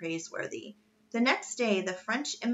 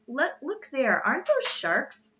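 put-on higher, eager boy; raised-pitch excited question; excited sighting; 6 semitones higher, 4 dB louder than usual. A narrator's reading. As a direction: point out a sudden sight, pitch lifted. Boy spots sharks mid-conversation.

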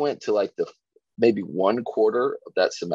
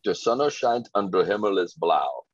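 German sentence. Retold or imitated. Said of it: "I have one German sentence that I can say".

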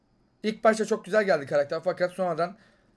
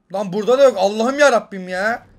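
frustrated outcry; 5 semitones higher, 11 dB louder than usual. Real, not acted. Gamer complaining to viewers about missing loot, loud and exasperated. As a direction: wail it at the empty spot.

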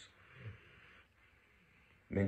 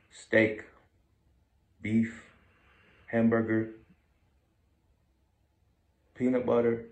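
loud, clear food words; 3 semitones higher, 8 dB louder than usual. Real, not acted. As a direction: call out each word loudly. Testing if sleeping dog wakes. (llamando fuerte)

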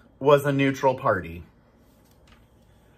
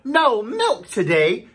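a much higher, louder, slow anguished cry; dismayed cry; 9 semitones higher, 5 dB louder, slower than usual. Acted. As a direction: wail it like a farmer in shock. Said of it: "cried Farmer Brown".

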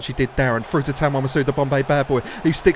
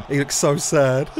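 ordinary talk

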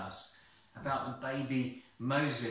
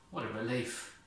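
ordinary delivery

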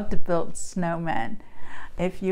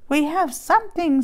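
a lightly higher little mouse voice; tiny squeak; 7 semitones higher than usual. Acted. Field mice offering buttons to the animals, light squeaky voice. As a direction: pipe it up small like a field mouse. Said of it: "squeaked a tiny voice".

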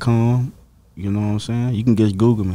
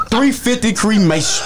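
laughing shout; 10 semitones higher, slower than usual. Pretend. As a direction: shout the punchline through laughter.

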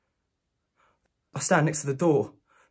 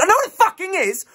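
much higher, loud exasperated shout; furious outburst; 6 dB louder than usual. Acted. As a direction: blow up at the receptionist. Snaps at receptionist explaining A and E.

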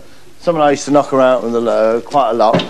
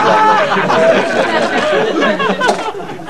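squeaky catchphrase; much slower than usual. Pretend. Catchphrase after showing cake figures.

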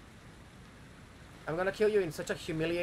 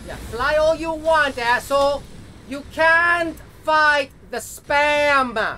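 high, very loud taunting shout; triumphant yell; 10 semitones higher, 13 dB louder, slower than usual. Real, not acted. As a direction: scream it at the enemy. Yelling at boss in fight.

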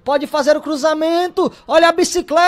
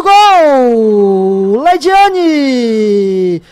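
excited goal call; 5 semitones lower, much slower than usual. Real, not acted. Goal scored, live call.

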